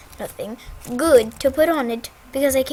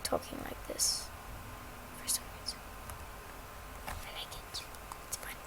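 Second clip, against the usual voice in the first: whispered aside; 17 dB quieter, faster than usual. Real, not acted. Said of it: "this is a fake accent".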